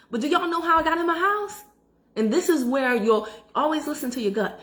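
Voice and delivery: ordinary delivery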